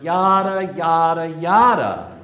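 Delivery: dismissive mimicry. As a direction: toss off empty promises, loudly and mockingly. Mocking promises Sarah is tired of.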